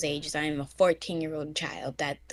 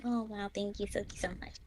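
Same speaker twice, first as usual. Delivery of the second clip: fake politeness; 9 dB quieter, faster than usual. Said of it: "I'm like".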